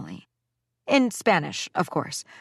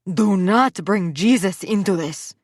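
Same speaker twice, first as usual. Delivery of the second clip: slow, loud, biting words with NOT hit hard; spat; 3 semitones higher, 5 dB louder, slower than usual. A narrator's reading. Mother spitting angry words at the father. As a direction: spit it at him. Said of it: "she spat at my father".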